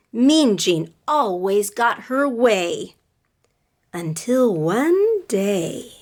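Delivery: slow, sing-song read-aloud; reading to children. A narrator's reading. Teacher reading a picture book to her class, sing-song. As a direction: read it with rhythm for little listeners.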